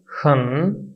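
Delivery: खन drawn down then up; third tone demo. Shown as a demonstration. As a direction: model खन with a dip and rise. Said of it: "इसकी pronunciation क्या होगी?". (खन तीसरी tone में)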